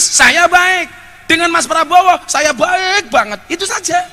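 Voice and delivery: high-pitched oratory in punchy parallel phrases; rousing preaching. Real, not acted. Preacher assuring the crowd he backs no candidate, rousing preaching.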